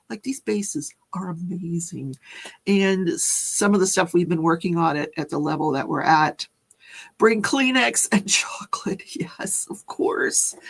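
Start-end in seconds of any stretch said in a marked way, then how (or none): 0.1-2.1 unvoiced aside whisper. Real, not acted. Telling viewers about the game bases, whispered. 7.2-10.6 playful whispered aside. Real, not acted. Telling viewers to bring tissues, whispered and laughing. Joking aside about crying.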